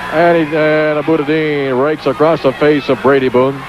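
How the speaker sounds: high, fast ringside call of the match; excited play-by-play. Real, not acted. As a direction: call every move like a ringside announcer.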